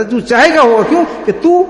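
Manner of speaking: high-pitched, impassioned proclamation; fervent preaching. Acted. Preacher voicing God to the Prophet's lover, impassioned. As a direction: declare it from the pulpit with feeling.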